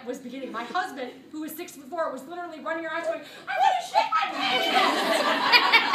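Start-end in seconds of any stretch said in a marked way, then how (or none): 3.5-5.9 very high, loud, slow laughing howl; desperate wail. Retold or imitated. Husband running around, diarrhea starting.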